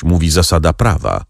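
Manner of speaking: ordinary delivery, nothing marked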